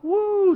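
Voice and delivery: imitated crowd cheer; crowd whoop. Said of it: "and making a racket".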